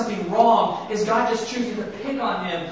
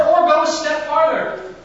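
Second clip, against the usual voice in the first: ordinary delivery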